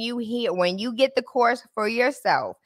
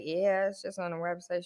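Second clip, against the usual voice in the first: deflated; 2 semitones lower, 12 dB quieter than usual. Retold or imitated. Deflated reply.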